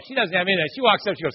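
normal talking voice

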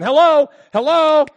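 high, loud hello; calling out hello. Retold or imitated. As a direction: call out as if nobody can hear you. Calling into phone, unheard.

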